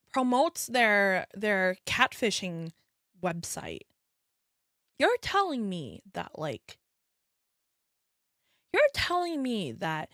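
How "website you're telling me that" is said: ordinary talk, nothing marked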